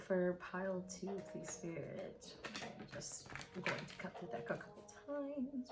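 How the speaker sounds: soft, near-whispered asking; quietly petition the spirit for guidance; hushed prayer to Spirit. Acted. Reader softly asks Spirit to clarify the card, near whisper.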